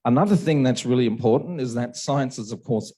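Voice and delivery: normal talking voice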